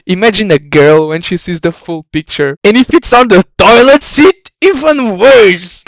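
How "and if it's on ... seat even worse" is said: louder, higher, stretched-out cry of disgust; mock-outraged yell, slowed on the last words; outraged shout. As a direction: blurt it out in horror. Outraged punchline about toilet seat.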